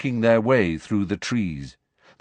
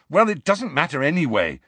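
frustrated; 7 semitones higher than usual. A narrator's reading. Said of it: "said Harry frustratedly".

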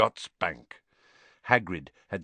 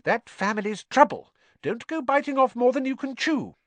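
very high, sharp wifely warning; 4 dB louder than usual. A narrator's reading. Missus Weasley warns Arthur about the Malfoys, sharp. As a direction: scold him in a high voice. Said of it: "said Missus Weasley sharply".

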